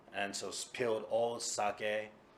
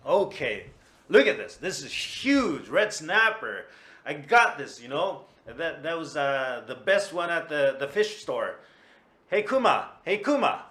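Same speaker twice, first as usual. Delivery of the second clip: higher, louder, proud and cheerful; proud excited; 6 semitones higher, 12 dB louder than usual. Acted. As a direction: show off the prize fish loudly. Tora-san returns proudly with fish.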